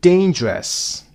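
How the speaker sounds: "dangerous" as DAIN-jerous, high on the first beat; stress on first syllable. Shown as a demonstration. Teacher introducing word stress, first syllable of "dangerous" stressed. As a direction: punch the start of "dangerous", then fall.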